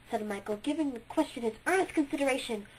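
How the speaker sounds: plain reading voice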